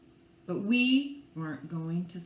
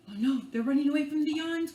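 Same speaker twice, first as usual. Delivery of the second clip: plain reading voice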